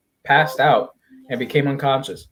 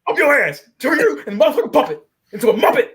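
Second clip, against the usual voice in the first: hammed-up furious shouting; much faster than usual. Angry father threatening the doctor over his baby, hammed-up high yelling. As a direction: screech out a mock tirade on the edge of laughter.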